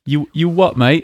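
high, bouncy British "You, you what, mate?"; British accent. Shown as a demonstration. Showing a friend how Brits say it, in a cheeky British accent. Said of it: "That's how they say it".

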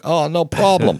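high, loud mock compliance; breezy agreement. Friends answering the shop boss, breezy agreement. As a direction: cheerfully brush off a warning. Group's breezy reply in story.

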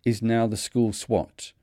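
normal talking voice

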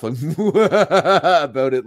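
slow words broken by laughter; laughing. Real, not acted. Fan picturing laughing alone at home, laughing. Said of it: "I would just laugh".

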